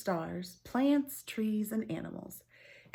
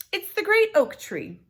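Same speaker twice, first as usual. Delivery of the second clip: much higher, loud, overconfident answer; bold lie; 13 semitones higher, 9 dB louder than usual. Acted. Sisters answering the sister with a confident lie. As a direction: chirp the lie out proudly.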